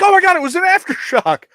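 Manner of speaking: imitating panicked caller. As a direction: play the friend gasping about the aftershock. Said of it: "And they're like".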